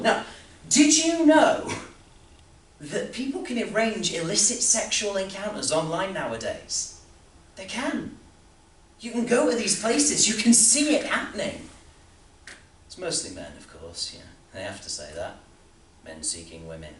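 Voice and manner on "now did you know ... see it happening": loud, high-pitched incredulous ranting; worked-up outburst. Acted. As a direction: exclaim it loudly, high in your range. Worked-up outburst about online encounters.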